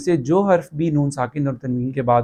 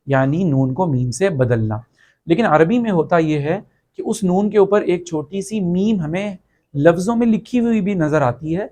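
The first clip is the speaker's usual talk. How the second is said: normal talking voice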